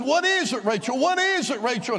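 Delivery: high-pitched eager pleading; urgent repeated question, jumping up in pitch; retold urgent questioning. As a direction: reenact pressing a wife for news. Acts out anxious questioning of wife.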